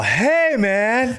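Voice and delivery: high, hammed-up offended "hey, man"; mock indignant hey. Comedian caught out by the heckle, high mock protest. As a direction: squeak out a shocked protest. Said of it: "he's all".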